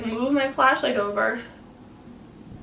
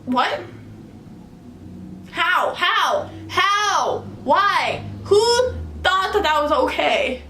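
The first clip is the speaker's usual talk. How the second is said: rising high incredulous questions, slower at the end; each how higher in disbelief; 7 semitones higher, much slower than usual. Retold or imitated.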